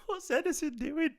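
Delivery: high-pitched giggly disbelief; giggles. Real, not acted. Fan baffled by keeper's back heel, giggling. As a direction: squeak the words out through laughter. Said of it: "I've got the giggles".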